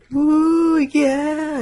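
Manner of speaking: high, loud drawn-out whoop; mock catcall. Friend imitating guys whistling at a girl, catcall whoop. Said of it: "whistling at her".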